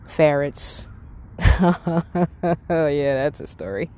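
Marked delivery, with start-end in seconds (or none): 0.9-2.6 laughing. Real, not acted. Laughing at a ferret memory.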